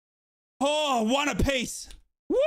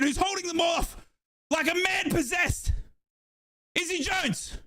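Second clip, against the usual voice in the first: live play-by-play; 7 semitones higher than usual. Real, not acted. Fan streamer calling the match live for chat, excited commentary. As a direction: call the attack as it unfolds.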